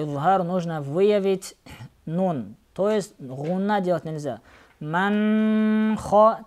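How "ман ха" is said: "Ман ха" with a long nasal hum on н; drawn-out nasalized n in "Ман"; wrong ghunna. Shown as a demonstration. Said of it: "так нельзя читать".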